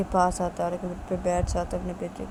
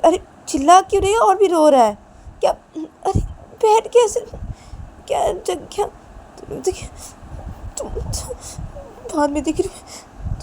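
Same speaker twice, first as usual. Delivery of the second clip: higher and louder, defensive complaining; raised argument; 12 semitones higher, 7 dB louder than usual. Acted. Husband shouts back at his wife, annoyed. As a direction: throw the blame right back at her.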